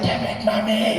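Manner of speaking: loud imitation of his friend's outburst; mock yell along. Watcher joining his friend's daily outburst, mock yelling along. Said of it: "I'd even mouth it with him".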